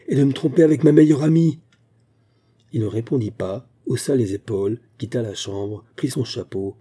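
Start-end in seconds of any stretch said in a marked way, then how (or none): 0.1-1.6 loud, higher accusation; angry cry. A narrator's reading. Wife crying out that he betrays her with her best friend, loud. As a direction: fling the accusation at him. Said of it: "cria-t-elle".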